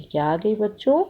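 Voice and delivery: usual speaking voice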